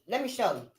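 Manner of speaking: ordinary delivery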